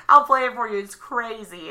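normal talking voice

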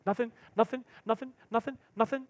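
put-on high, quick denials like a caught boy; scared kid. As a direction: play the kid frantically denying. Kid denying, voiced in story.

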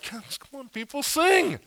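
high-pitched plea starting under the breath; exasperated pleading. Acted. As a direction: coax a reluctant crowd to join in, pitched high. Song leader urging reluctant congregation.

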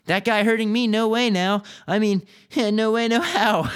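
acted cocky protest; scoffing denial. A narrator's reading. Boy scoffs at being hurt.

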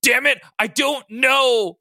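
loud, high, slow cry breaking into laughter; cried out. Acted. Logan crying out to Maddie, laughing helpless shout. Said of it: "Logan cried out".

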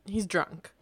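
ordinary delivery

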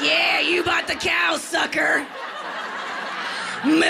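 cheeky shouted gloat; mock taunt. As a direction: rub it in to husband.

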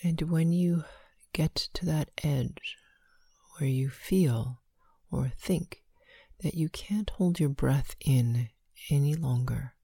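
slow, hushed breath counting. Acted.

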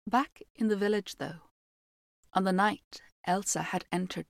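measured fairy tale telling; story narration. A narrator's reading. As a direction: read it like a storyteller by the fire. Reads fairy tale aloud.

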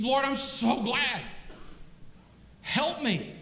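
high-pitched, pleading man in trouble; desperate climber. Acted. Man on the branch begs the Lord, high pleading voice. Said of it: "He said".

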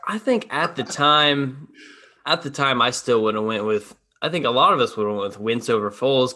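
ordinary talk

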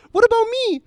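high and loud, whiny mocking complaint; shrill falsetto protest, hammed up; mock whiny woman. Retelling the woman's outburst to his friend, loud high whine. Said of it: "she does this".

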